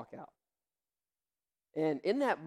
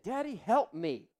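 put-on child pleading; 4 semitones higher, 6 dB louder than usual. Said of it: "He said".

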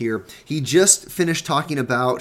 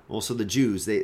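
ordinary talk

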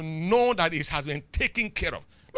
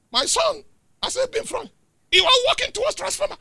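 calling out; 4 dB louder than usual. Retold or imitated. Retelling calling out to son.

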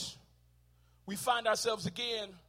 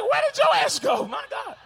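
much higher, louder, slow shout of alarm; mock shout; 8 dB louder than usual. Preacher pretending to search for Joash, loud. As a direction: call out in fake panic.

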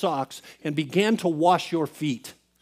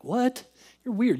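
high-pitched incredulous reply; taken aback; 7 semitones higher than usual. Retold or imitated. Man answering his friend's odd word, taken aback. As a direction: react in mock disbelief.

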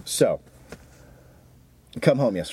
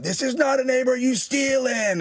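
high-pitched re-enacted shout; high mock yell, stretched at the end; retold yelling; 12 semitones higher than usual. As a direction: yell an accusation down the street, pitched way up. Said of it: "and just yelling".

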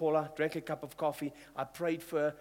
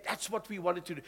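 ordinary delivery

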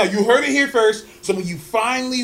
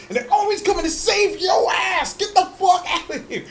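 high, scolding shout at the screen character; mock yell; 7 semitones higher than usual. Fan shouting at a TV character, high mock yell. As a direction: yell at her to get out.

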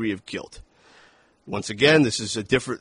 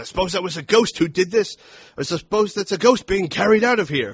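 raised-pitch mock Loomis, sarcastic; Loomis retort; 8 semitones higher, 4 dB louder than usual. Podcaster quoting Loomis's sarcastic retort in a higher mock voice. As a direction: sneer it up high like an indignant old doctor. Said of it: "Where Loomis responded".

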